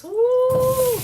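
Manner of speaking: high loud woo; loud happy woo; excited whoop. Real, not acted. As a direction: whoop with delight at the sizzle. Onions hit the hot pan.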